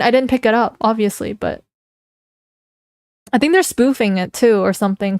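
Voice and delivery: normal talking voice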